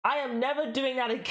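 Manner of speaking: emphatic AM, breaking into high laughter; laughing vow. Real, not acted. Streamer embarrassed after the accent attempt, real laughing vow. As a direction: swear it off, then crack up.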